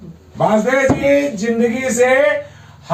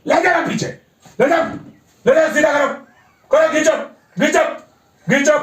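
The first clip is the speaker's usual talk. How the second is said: urging on; 5 semitones higher, faster than usual. Real, not acted. Therapist urges the arm back.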